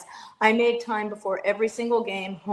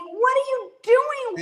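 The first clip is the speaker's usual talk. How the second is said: much higher, exasperated yell at the football coach; mock screaming at the TV; slower than usual. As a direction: yell at the screen like a frustrated fan. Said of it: "but I'm screaming".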